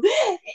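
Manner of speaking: a sharp high gasp with no voice behind it; choked gasp. Shown as a demonstration. On the drop tower, the voice stuck in her throat, gasping. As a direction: show the scream that won't come out. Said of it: "es como".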